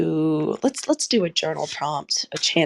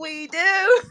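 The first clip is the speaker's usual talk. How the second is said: real laughter; 14 semitones higher, 4 dB louder than usual. Real, not acted. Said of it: "I'm laughing and crying".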